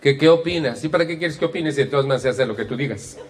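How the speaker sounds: hammed-up bitter retort; resentful tone. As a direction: rattle off a martyred complaint. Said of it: "el tono de voz es más o menos así".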